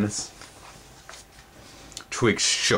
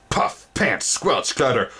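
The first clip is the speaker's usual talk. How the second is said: sound effects; 6 semitones higher, slower than usual. A narrator's reading.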